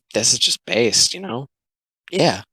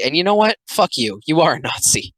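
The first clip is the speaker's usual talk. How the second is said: ordinary talk, nothing marked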